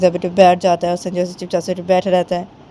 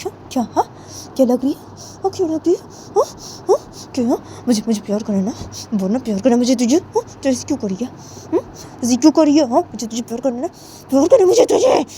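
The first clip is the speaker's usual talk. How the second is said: higher, needling questioning voice; insistent Sanju; 6 semitones higher, faster than usual. Acted. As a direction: press for an answer, pitch climbing. (ज़िद भरी ऊँची आवाज़ में सवाल)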